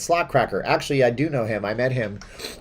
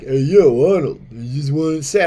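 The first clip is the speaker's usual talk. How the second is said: a touch of a mumbled Stallone New York voice; lightly done Rocky-style Stallone; Stallone impression; 3 semitones higher, 6 dB louder than usual. Stallone feuding with Arnold in a video, lightly done New York accent. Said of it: "he's like".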